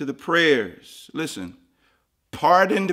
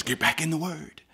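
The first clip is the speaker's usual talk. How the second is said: soft voice; 8 dB quieter than usual. Acted. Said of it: "the Holy Spirit says".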